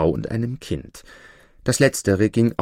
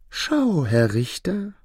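devil; 8 semitones higher, slower than usual. Acted. Devil explains himself to judge.

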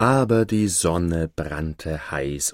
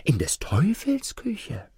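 a sly, secretive whisper; 9 semitones higher than usual. Acted. Young lad whispering to Hans, sly and secretive. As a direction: lean in and whisper the scheme.

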